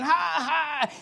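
high, put-on "ha ha"; mock a husband's laughter; imitated laugh. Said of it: "He started laughing".

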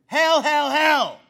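loud, high repeated hell; emphatic shouting. Real, not acted. Preacher hammering the word hell at critics. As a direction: hammer each hell hard.

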